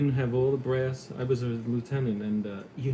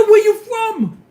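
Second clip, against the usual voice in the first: much higher, loud shouted question, laughing; mock yell; 17 dB louder, much slower than usual. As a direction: bellow it out the car window. Voicing driver yelling again.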